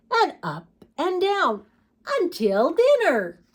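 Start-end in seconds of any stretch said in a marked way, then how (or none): none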